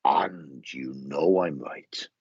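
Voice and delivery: slow, drawn-out and knowing; lightly voiced lady, slow and knowing; her knowing tone. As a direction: say it slowly, sure you're right. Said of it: "She'd go".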